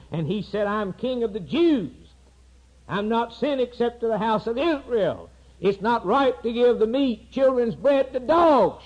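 high, ringing pulpit voice; fiery preaching. Acted. Preacher giving Christ's answer to the woman, high and ringing. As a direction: proclaim it over the crowd.

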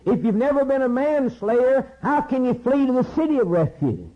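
high-pitched pulpit cry, sustained; impassioned preaching. Real, not acted. Preacher challenges congregation on needing refuge, high impassioned preaching. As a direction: drive each rhetorical question home, voice pitched high.

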